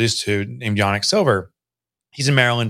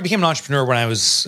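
normal talking voice

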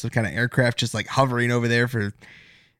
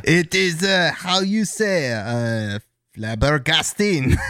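French chef; 2 semitones higher, much slower than usual. Pretend. Mock French accent, slow.

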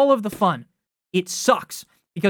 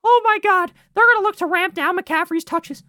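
shrill-free mock gasp of alarm; mocking offseason chatter; 8 semitones higher than usual. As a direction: parrot the hysterical pundits. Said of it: "there was a lot of talk in the offseason".

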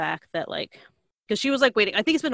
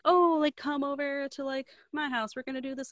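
lightly acted, higher-pitched invitation; inviting friend; 8 semitones higher than usual. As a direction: imitate a friend casually inviting someone over.

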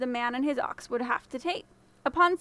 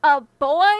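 a loud, incredulous scoff; scornful scoff; 9 dB louder than usual. Acted. The thieves scoffing at the boy as master, loudly. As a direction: sneer at the very idea. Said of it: "they scoffed".

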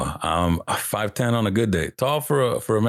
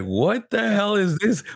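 baffled; 10 semitones higher, slower than usual. Retold or imitated. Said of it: "I was like".

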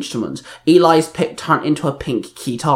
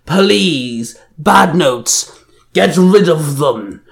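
slow villain roar, mildly raised; roared command; 2 semitones higher, 4 dB louder, much slower than usual. A narrator's reading. Villain orders his minions to attack, roaring. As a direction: roar the order, dragging the last words. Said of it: "Banjan roared".

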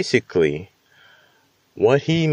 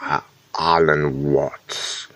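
slow, drawn-out accented Alan Watts; slow hint of a British accent on Alan Watts; put-on accent; 4 semitones lower, slower than usual. Shown as a demonstration. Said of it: "I wanna say it in the accent".

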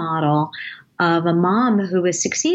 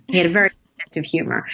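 ordinary delivery

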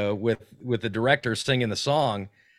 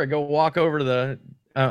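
normal talking voice

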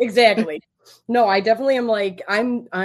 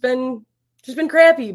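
frustrated outburst; 5 semitones higher, 8 dB louder than usual. Real, not acted. Emotional outburst about hard times.

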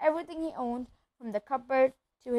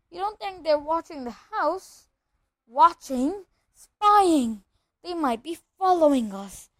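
higher, anxious, quivering voice; 5 semitones higher, 6 dB louder than usual. A narrator's reading. Aunt and uncle fearing they are watched, quivering. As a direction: fret with mounting alarm. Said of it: "saying in a quivering voice".

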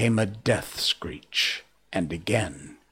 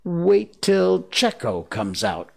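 slow, raised-pitch mild growl; 9 semitones higher, 3 dB louder, slower than usual. A narrator's reading. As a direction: drag it out in grudging defiance. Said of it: "growled Starkey".

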